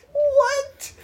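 a startled, incredulous what; mock surprised what. Retelling shock at friend, mock surprise. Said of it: "I was like".

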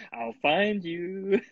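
slow, drawn-out mock threat; mock menace. Friend jokingly threatening to track him down, slow mock menace. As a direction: stretch it out like a playful stalker.

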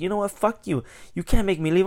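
high-pitched mock indignation; retold angry defiance. Customer refusing to leave the store, high mock indignation. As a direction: act out a customer refusing to budge.

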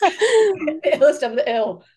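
slow words with a laugh in them. Real, not acted. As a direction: draw it out while laughing. Host echoing guest, laughing.

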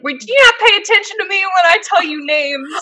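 high-pitched exasperated challenge; indignant scolding. Real, not acted. Host to forgetful co-host, indignant scolding. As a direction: call out a friend in high indignation.

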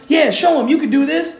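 retold urging. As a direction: egg someone on to perform, imitating onlookers. Mimics people pushing him to perform.

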